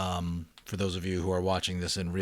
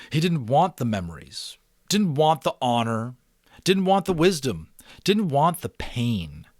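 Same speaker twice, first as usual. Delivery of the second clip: each didn't want pressed louder; insistent repetition; 6 semitones higher, 8 dB louder than usual. A narrator's reading. Narrator voicing Jonas refusing his burden, insistent rising stress. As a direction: build the refusals, slowing on the pain.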